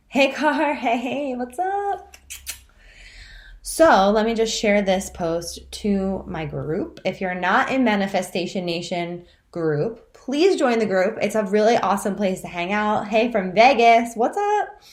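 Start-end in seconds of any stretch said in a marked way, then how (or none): 0.1-2.2 excited greeting. Real, not acted. Greeting live viewers by name. 13.1-14.8 excited greeting. Real, not acted. Greeting live viewers.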